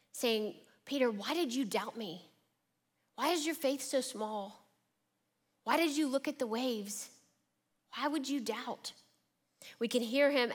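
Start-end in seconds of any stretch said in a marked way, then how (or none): 0.9-8.9 exasperated questions, pitched a little up; frustrated. Acted. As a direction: put frustration into each reproachful question.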